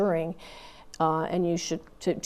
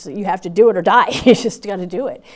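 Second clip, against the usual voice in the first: louder, forceful insistence with a laugh; emphatic; 10 dB louder than usual. Real, not acted. Telling the interviewer Marines have no choice, loud and laughing. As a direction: drive home that there is no choice.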